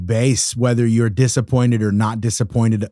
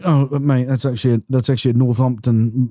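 imitating British producer. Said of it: "Mike ... going".

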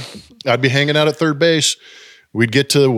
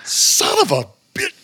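exasperated. Retold or imitated. Realizes he has been pranked.

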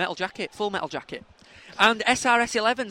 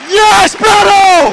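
long goal yell; 12 semitones higher, 15 dB louder, much slower than usual. Real, not acted. Goal scored in live match.

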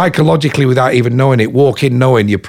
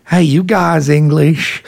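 put-on slow, curious American accent, a little higher; 4 semitones higher, slower than usual. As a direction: play an American noticing the English accent. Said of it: "this kerfuffle with these accents".

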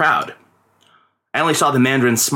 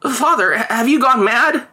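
put-on much higher, alarmed boy; mock-frightened child protest; 10 semitones higher, 3 dB louder than usual. A narrator's reading. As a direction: protest in disbelief. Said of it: "he protested".

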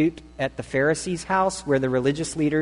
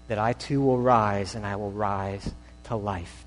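humble prayer; 4 semitones lower, 6 dB quieter than usual. Acted. Quoted believer's prayer before God.

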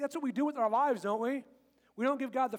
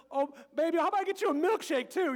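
mock doting dad; 7 semitones higher, 5 dB louder than usual. Father melting at daughter.

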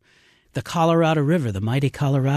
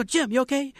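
high-pitched worried check; mock concern; 13 semitones higher than usual. Guide acting out checking on Jim, mock alarm. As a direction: call over to him, pitched way up.

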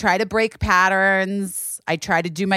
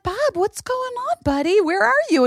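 high aunt voice; 13 semitones higher than usual. Retold or imitated. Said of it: "I was like".

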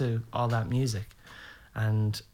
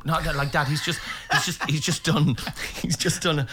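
higher, laughing protest; pleading restraint; 6 semitones higher than usual. Acted. Son holds Dad back from singer, laughing plea. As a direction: plead with him through laughter.

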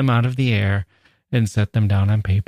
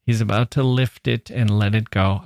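ordinary talk

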